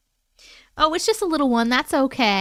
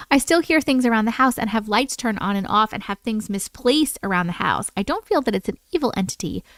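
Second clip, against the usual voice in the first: story narration; 3 semitones lower than usual. Acted. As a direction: read it like a campfire tale. Reads listener's scary story.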